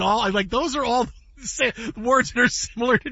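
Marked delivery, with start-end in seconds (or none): none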